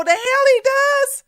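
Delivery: high, slow scoff; high dismissive comeback; scoffing retort. Real, not acted. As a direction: toss it back in disbelief. Retorting to imagined claim.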